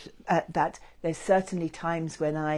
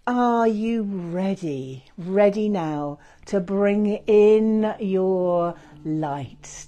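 guided meditation; 3 semitones higher, 5 dB louder than usual. Acted.

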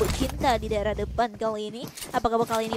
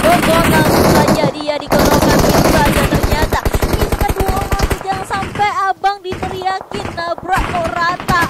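excited caster call; 4 semitones higher, 8 dB louder than usual. Real, not acted. Caster hyping vehicle kills.

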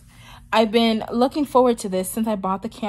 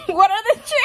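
high, laughing disbelief; incredulous laughter; 12 semitones higher, 5 dB louder, faster than usual. Real, not acted. Vlogger marvelling at bad luck, incredulous laughter. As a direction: burst out laughing at the rotten luck. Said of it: "It's not funny".

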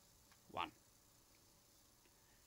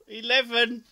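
excited cheer; 7 semitones higher, 8 dB louder than usual. Real, not acted. Counting her marshmallows, cheering.

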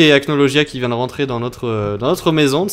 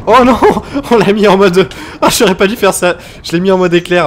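higher, louder laughing alarm; real laughing dismay; excited dismay; 6 semitones higher, 9 dB louder than usual. Real, not acted. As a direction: cry out at your own blunder. Game mistake, laughing.

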